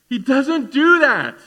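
ordinary delivery

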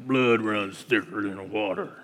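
deep gravelly Western movie voice; Sam Elliott drawl. Retold or imitated. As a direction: growl it like an old Western cowboy. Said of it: "That's my best, uh, Sam Elliott impersonation".

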